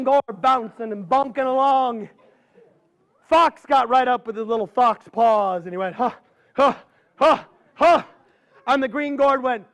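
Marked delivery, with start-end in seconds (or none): 5.9-8.0 fox huffing. Acted. Fox squares up to fight.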